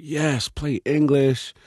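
drawn-out, eager go-ahead; mock studio voice granting the request; producers' reply. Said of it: "And they were like".

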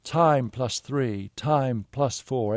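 slow, measured PA call; slow announcement. Retold or imitated. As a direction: read it out slowly like a PA. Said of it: "this announcement continued".

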